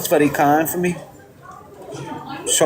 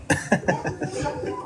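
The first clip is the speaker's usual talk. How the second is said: laugh; 4 semitones higher, 10 dB quieter than usual. Real, not acted. Laughs after refusing the bike.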